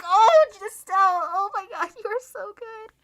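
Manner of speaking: high, swooning gush over a character; gushing fangirl. Retold or imitated. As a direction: swoon at her in a squeaky, adoring voice.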